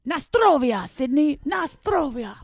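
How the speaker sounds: high, hearty toast in a mock Polish accent; attempted Polish accent. Host signing off a listener's review, mock Polish accent. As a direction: call it out with an amateur Polish accent. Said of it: "I'll just do that at the end".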